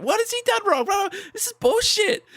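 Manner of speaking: very high, squealed mock protest; girls piping up. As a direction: imitate indignant girls in a high-pitched voice. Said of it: "all the girls started piping up".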